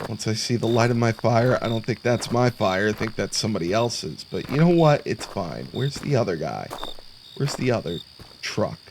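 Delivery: ordinary talk, nothing marked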